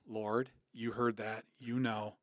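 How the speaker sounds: soft and slow, as if praying under his breath; hushed, slow prayer. Retold or imitated. Man turning to the Lord after bad news, hushed and slow. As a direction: let each plea drop quiet and unhurried.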